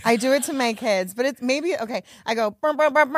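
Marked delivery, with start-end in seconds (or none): 2.5-3.2 loud high mouth air horn; air horn noise. Pretend. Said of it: "I was gonna do an air horn with my mouth".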